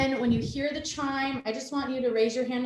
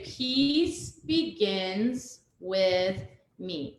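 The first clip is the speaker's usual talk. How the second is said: slow mantra; much slower than usual. Shown as a demonstration. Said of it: "So you're gonna say".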